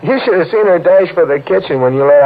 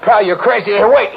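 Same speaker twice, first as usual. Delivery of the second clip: ordinary talk, nothing marked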